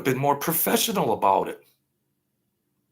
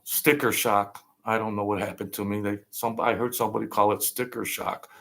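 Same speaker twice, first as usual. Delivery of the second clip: ordinary talk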